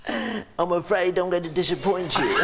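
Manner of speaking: high, slow comic impression of a hapless man; apologetic sitcom simpleton, pitched high; Frank Spencer. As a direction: drag it out in a high put-on voice. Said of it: "don't do a Frank Spencer impression".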